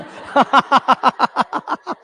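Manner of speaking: high burst of laughter; laughing. Real, not acted. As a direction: laugh out at the request. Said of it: "पूरा गा के बताओ".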